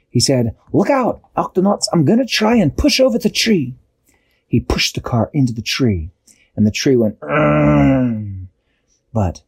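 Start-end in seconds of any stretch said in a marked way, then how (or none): 0.7-3.7 high-pitched heroic warning; urgent warning. A narrator's reading. Shellington warns Octonauts from the gup, urgent high call. 7.2-8.5 long straining groan, sliding down; tree groan. A narrator's reading. Storyteller voices the pushed tree, long groan.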